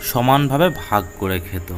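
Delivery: usual speaking voice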